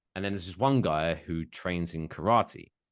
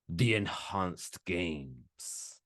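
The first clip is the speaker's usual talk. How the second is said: mock grand name; 3 semitones lower, 6 dB quieter, much slower than usual. Mocking the name.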